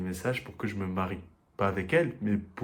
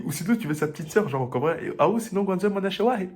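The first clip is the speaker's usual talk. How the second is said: higher, louder pushy offer; eager insistence; 10 semitones higher, 5 dB louder than usual. Retold or imitated. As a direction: upsell the little sister eagerly, higher each time. La dame insiste avec la sœur. (la dame qui insiste, voix aiguë et excitée)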